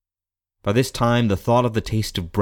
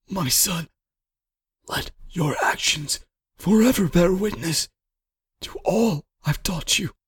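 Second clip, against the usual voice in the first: high, halting, short-of-breath father; strained with pain; 10 semitones higher than usual. A narrator's reading. Dying father gives his son last counsel, strained. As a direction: force the words out through pain. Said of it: "his voice strained from the pain".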